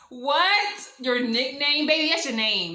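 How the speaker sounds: loud, high disbelieving retort; incredulous outburst. Retold or imitated. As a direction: blurt it out in outraged disbelief. Reacts to his nickname excuse.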